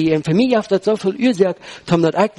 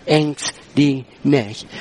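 slow, low scripture quote; measured, weighty command in a lower pitch; slow, deliberate; 4 semitones lower, much slower than usual. Retold or imitated. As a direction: set each word down separately. Preacher quotes Jesus's command.

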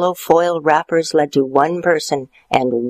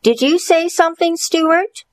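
teacher voice; 13 semitones higher than usual. Acted.

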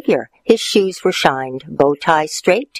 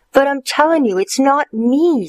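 put-on boy voice; 10 semitones higher than usual. Stuart protests high.

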